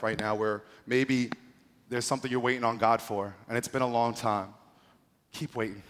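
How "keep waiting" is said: gentle encouragement. Real, not acted. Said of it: "encouraging the congregation".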